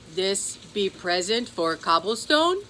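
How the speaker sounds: slow, odd gruff little leprechaun; a grumpy old leprechaun asking. Acted. Cobblestone the leprechaun questioning the gift, slow odd voice.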